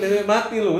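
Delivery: a teasing laugh mid-line. Real, not acted. Host joking about the lawyer going on hunger strike, laughing.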